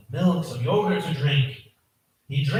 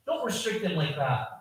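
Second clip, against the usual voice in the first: ordinary talk, nothing marked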